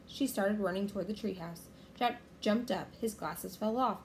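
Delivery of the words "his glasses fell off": usual speaking voice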